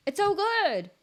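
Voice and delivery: high, loud squeal of admiration; gushing. Real, not acted. Streamer admiring a viewer's art, high excited gush.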